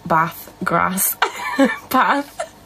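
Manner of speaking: short northern 'a' in Bath, grass, path; northern English accent. Shown as a demonstration. Northerner answering southern friends, short northern English vowels. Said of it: "And I'm like".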